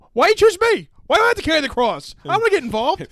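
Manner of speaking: high, loud complaining voice; whiny Simon. Pretend. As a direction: play Simon refusing the cross, loud and high. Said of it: "It's like".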